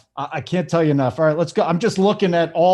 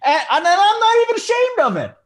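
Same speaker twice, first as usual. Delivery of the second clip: excited; 3 dB louder than usual. Real, not acted. Bragging about a hot streak.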